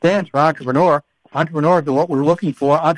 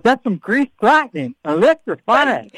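slow, higher Electrifying with a southern twang; southern accent; 7 semitones higher, slower than usual. Retold or imitated. Host echoes her word in a southern accent. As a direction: draw out Electrifying in a southern drawl. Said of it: "I love it when you do the Greek lightning, like you have a southern accent".

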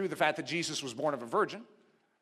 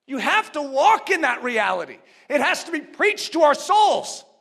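higher and louder, slowing to drive it home; rising preaching; 5 semitones higher, 4 dB louder than usual. Acted. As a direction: preach it with conviction. Preaching at full force.

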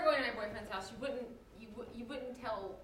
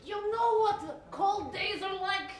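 foul mouthed granny; 6 semitones higher, 6 dB louder, slower than usual. Acted. S0 switches into Grandma role.

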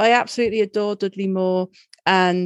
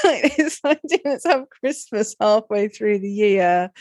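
laughing. Real, not acted. Mocking film plot while laughing.

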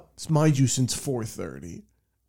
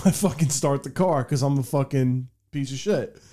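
normal talking voice

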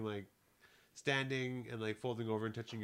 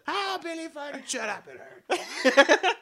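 high, loud mock whine; mocking uncle; 9 dB louder than usual. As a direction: whine like the pained uncle, laughing. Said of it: "Every time".

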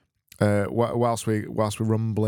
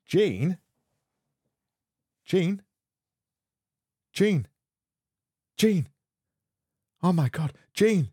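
rising higher, hammed-up calling a collapsed friend; hammed-up panicked calls for Gene; mock panic; 9 semitones higher, slower than usual.

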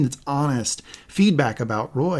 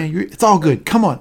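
louder, upbeat team encouragement; pep-talk cheer; 4 semitones higher, 6 dB louder than usual. Retold or imitated. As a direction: hype up a nervous teammate. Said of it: "Sam is like".

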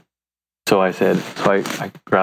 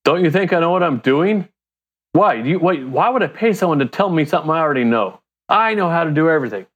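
higher, hurried, indignant know-it-all; proud man; 6 semitones higher, faster than usual. Acted. Man voicing the proud fool who rejects advice, indignant. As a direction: play a proud fool rushing to refuse help. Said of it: "the proud man says".

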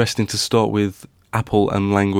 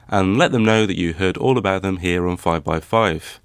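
ordinary talk

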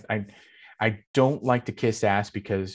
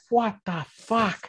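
retold shocked yell; 12 semitones higher, slower than usual. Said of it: "I, like, screamed that".

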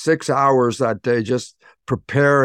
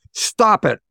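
higher, curt psychologist's order; Bob Newhart; 8 semitones higher than usual. Retold or imitated. TV psychologist to a patient, abrupt comic command. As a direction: imitate a therapist cutting in abruptly. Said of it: "Bob Newhart played a psychologist".

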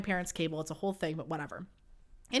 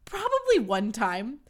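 normal talking voice

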